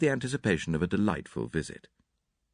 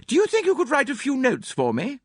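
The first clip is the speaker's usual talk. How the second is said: put-on high, fluttery elderly lady; gushing hostess, a little theatrical; Mrs Inglethorpe; 5 dB louder than usual. A narrator's reading.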